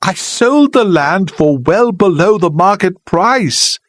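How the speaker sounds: apologise, near tears; helpless. Acted. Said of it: "she said helplessly".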